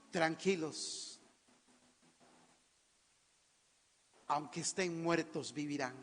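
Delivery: low, soft, with long pauses; calm reassurance. Retold or imitated. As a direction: settle them down gently. Voices the Lord calming the people.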